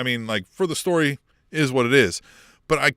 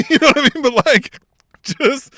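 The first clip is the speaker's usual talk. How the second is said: laughing; 7 semitones higher, 7 dB louder than usual. Real, not acted. Laughs at own slip.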